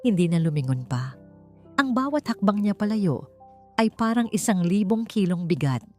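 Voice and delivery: ordinary delivery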